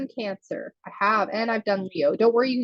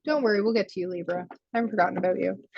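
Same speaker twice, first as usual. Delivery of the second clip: ordinary talk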